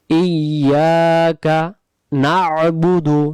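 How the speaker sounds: ইয়াকা নাবুদু drawn out slowly; slow recitation. Shown as a demonstration. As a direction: chant ইয়াকা নাবুদু with long held vowels. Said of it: "আবার". (ধীরে টেনে তিলাওয়াত)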